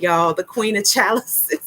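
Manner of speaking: ordinary talk, nothing marked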